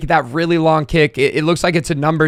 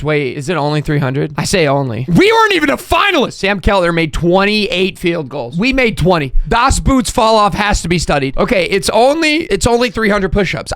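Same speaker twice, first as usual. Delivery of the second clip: ordinary talk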